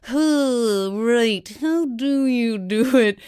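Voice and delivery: high, puzzled musing voice; puzzled wondering. Retold or imitated. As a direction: wonder aloud, baffled. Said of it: "you are like".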